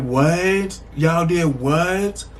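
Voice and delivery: drawn-out stunned what, slowed right down; can't believe what you just heard; slow, incredulous double take; shocked disbelief. Retold or imitated. Hearing the prank, shocked reply.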